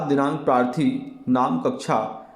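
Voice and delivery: ordinary talk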